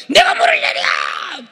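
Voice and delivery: high, slow hot-tempered shout; Moses. Acted. Preacher playing Moses boasting he brings water, enraged shout. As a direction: roar it out in a fit of temper. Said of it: "혈기 내가지고".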